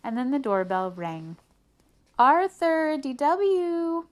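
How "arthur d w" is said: high, loud singsong summons; calling out. A narrator's reading. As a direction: call the kids from another room. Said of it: "Mom called".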